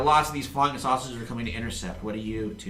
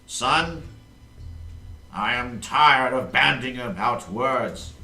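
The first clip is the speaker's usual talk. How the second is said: booming, slow imperial ultimatum; villain king; 6 dB louder, slower than usual. Acted. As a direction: talk down to your son like a tyrant. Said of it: "he's like".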